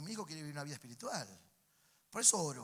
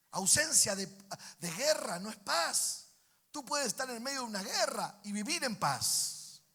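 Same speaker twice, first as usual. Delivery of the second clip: loud, higher-pitched sermon delivery; preaching at full force; 7 semitones higher, 7 dB louder than usual. Real, not acted. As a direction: drive the point home to the congregation.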